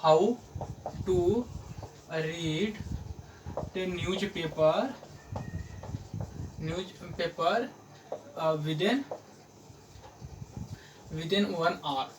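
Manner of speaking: word by word with long pauses; slow dictation. Real, not acted. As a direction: spell out the title slowly, as if writing it down. Writing out the video's title aloud. (धीरे-धीरे, रुक-रुक कर लिखते हुए बोलना)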